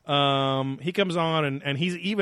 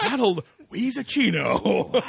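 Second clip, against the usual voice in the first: silly name voice; 7 semitones higher, 3 dB louder, slower than usual. Pretend. Joking riff.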